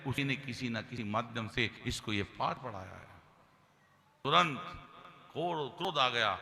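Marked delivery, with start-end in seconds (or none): none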